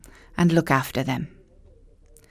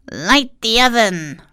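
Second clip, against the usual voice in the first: sweet, higher crone voice; old witch voice; 5 semitones higher, 3 dB louder than usual. Acted. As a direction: lure them in as a sly old witch. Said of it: "she told Gretel".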